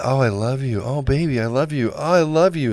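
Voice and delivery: high, breathy mock lover; mock pleading. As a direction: play it sappy and over the top to placate. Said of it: "Just be like".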